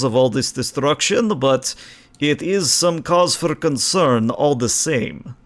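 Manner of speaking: put-on a light Slavic accent for the science hologram. Game master voices the hologram officer reporting to the captains, light Slavic accent. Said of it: "I see enough people going for Slavic".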